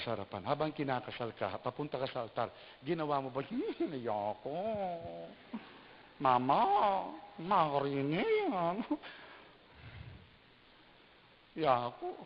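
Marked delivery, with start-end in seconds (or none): 3.4-12.1 higher sulky refusal; reluctant bride. Retold or imitated. (nagmamaktol na nobya)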